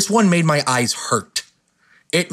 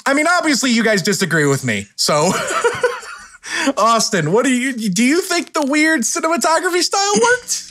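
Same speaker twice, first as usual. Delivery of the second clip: laughing; 10 semitones higher than usual. Real, not acted. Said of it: "laughing after the bit".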